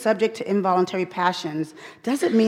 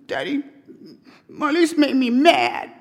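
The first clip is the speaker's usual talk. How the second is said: daughter tattling; 5 semitones higher, 4 dB louder than usual. Retold or imitated. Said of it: "and she'd be like".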